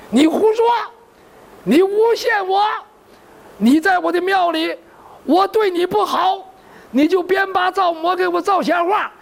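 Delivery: monk denying angrily. Acted. Monk denies Zhao Bi's accusation, high and indignant.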